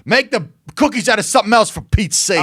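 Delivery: hammed-up shout of complaint; mock outraged yell. Joining the joke, yelling as the annoyed listener in mock outrage. Imitating the cookie critic.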